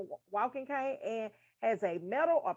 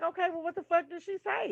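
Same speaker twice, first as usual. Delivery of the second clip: high-pitched, exasperated comeback; retold exasperated retort; 7 semitones higher than usual. Hard-of-hearing [name] answers friends, exasperated high retort. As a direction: fire back the question, exasperated. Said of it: "I'm like".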